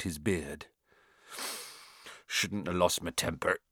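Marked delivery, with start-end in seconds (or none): 2.3-3.6 lower, softer, regretful; rueful. A narrator's reading. Hagrid regretting his lost temper, ruefully. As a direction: admit it sheepishly under the voice. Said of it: "he said ruefully".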